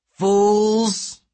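loud, high giant's roar. A narrator's reading. Giant roaring after fleeing heroes, furious bellow. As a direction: roar it out, long drawn. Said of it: "the giant roared".

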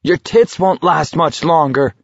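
high-pitched angry retort; snapping. A narrator's reading. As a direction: snap back at a heckler. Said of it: "Garrity snapped at her".

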